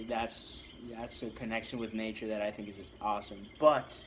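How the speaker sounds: usual speaking voice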